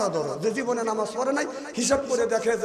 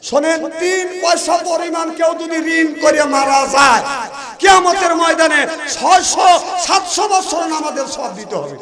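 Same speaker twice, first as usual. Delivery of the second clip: high, raised-voice preaching; emphatic warning; 7 semitones higher, 7 dB louder than usual. Real, not acted. Preacher repeating the penalty for dying in debt, emphatic. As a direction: drive the warning home with a raised voice.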